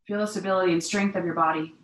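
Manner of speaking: usual speaking voice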